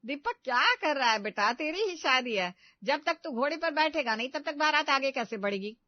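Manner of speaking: calling out. Acted. Mother calling to groom.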